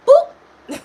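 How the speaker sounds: squeaky high playful boop; a cheeky little boop; sudden high squeaky boop. Acted.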